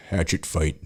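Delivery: understated matter-of-fact delivery; deadpan answer. Retold or imitated. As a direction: state it as if it were nothing. Big Fred's deadpan answer.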